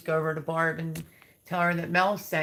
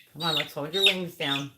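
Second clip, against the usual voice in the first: usual speaking voice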